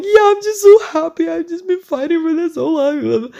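high, tearful voice of relief; happy crying. Retold or imitated. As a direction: sob it out, overwhelmed with joy. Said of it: "I just start bawling my eyes out".

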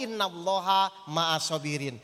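pelan dan jauh lebih rendah, seperti mengaji; lantunan ayat. Acted. Quoting Quran verse in Arabic. (ustaz melantunkan ayat untuk jamaah, khidmat dan pelan)

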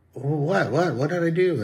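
high-pitched, protesting innocence; imitating a baffled husband; mock bewilderment. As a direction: play the clueless husband caught off guard.

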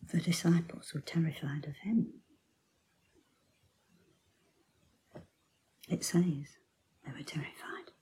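hushed storytelling whisper; whispered sermon storytelling, full of awe; awed whisper. Acted. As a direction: draw listeners in with a whisper. Hushed retelling of the disciples' awe.

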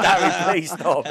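begging him to stop while cracking up; laughing plea. Real, not acted.

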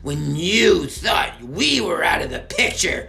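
mock his good nature; mocking sneer. Merkel mocking Alfie.

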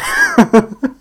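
high rising laugh; high giggle. Real, not acted. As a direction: giggle at your own silliness. Laughs at own bit.

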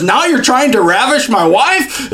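lightly hammed-up shocked king; mock outraged king. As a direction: play the king scandalised, half laughing. King catching Haman on bed.

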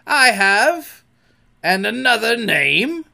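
high, loud exchange; bright-pitched playful calls; blithe guessing game. A narrator's reading. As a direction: call back and forth gleefully. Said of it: "answered blithely in his own voice".

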